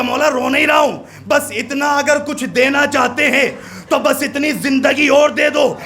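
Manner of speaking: preaching at full force. Acted. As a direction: preach it, rising to a shout.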